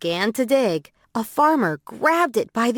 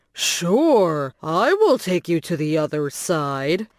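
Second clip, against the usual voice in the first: a lower, slow crocodile voice; 4 semitones lower, slower than usual. Acted. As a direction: sound like a sly crocodile hiding its hunger. Sly crocodile replies to bunny.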